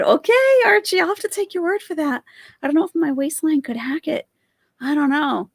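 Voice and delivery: high sugary voice mocking the vlogger; mimicking vlogger. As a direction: parrot her in a sweet, high, fast voice.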